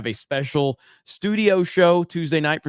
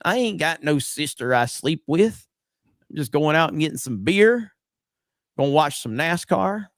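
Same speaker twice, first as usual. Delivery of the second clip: hammed-up Southern accent; 2 semitones higher, 3 dB louder than usual. Host jokingly denying his accent to a viewer, hammed-up Southern accent. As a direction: play up a Southern good-old-boy accent in mock denial. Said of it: "[name]'s Southern accent's really coming through".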